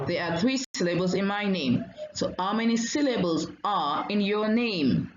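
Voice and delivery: usual speaking voice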